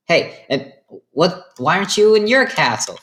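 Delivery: pressing and baffled; mock confused questioning; rising puzzled question. Summer Sus Baby questions Red Boy.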